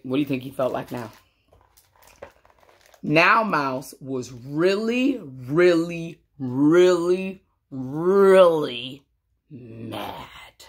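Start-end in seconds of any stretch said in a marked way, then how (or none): 3.0-9.1 drawn out slowly, leaning on really; slow emphasis. A narrator's reading. Reading to kids, building up how mad Mouse is. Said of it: "slowing for emphasis". 9.5-10.6 a soft unvoiced whisper. A narrator's reading. Reading to kids, whispering the last word.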